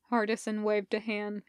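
usual speaking voice

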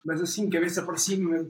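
ordinary talk, nothing marked

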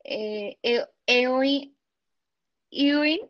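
ordinary delivery

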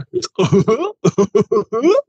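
high mock hooting, rising; cartoonish rising hoots poking fun at southerners; down-south impression. Shown as a demonstration. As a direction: hoot it as a southern caricature. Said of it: "this is my impression of someone who lives down south".

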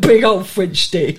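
hamming up a fancy bread order; mock posh order. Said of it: "mock posh shop order".

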